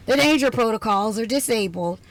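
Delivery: ordinary talk, nothing marked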